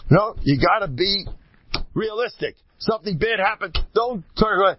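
high-pitched imitation of objectors; lightly mocking, high protest; mimicked naysayers. Mimics people objecting to his positivity.

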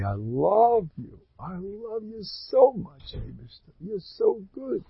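soft, slow and heartfelt, rising high on "so"; tender prayer to God. Shown as a demonstration. Preacher shows how to tell God you love him, soft and slow. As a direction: whisper your love up to God, slowly. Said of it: "I recommend saying this every day".

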